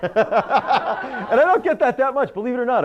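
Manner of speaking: high-pitched, real laughter in the voice; laughing. Real, not acted. Speaker tells audience he is seldom recognized, laughing. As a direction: laugh through the aside.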